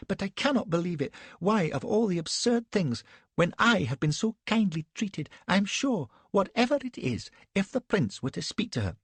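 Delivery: put-on high-pitched, petulant, breathless young lady; a shaken, indignant society wife; Elsbeth, fluttery young wife. A narrator's reading.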